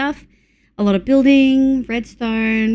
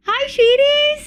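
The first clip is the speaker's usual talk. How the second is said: much higher, slow sing-song hello; cutesy coo; 13 semitones higher than usual. Real, not acted. Streamer cooing at chatters, high and drawn out. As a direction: coo like greeting a puppy.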